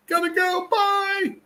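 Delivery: high squeaky little cat voice fleeing; scared cat. Pretend. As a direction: squeak it out like a cat bolting under the bed.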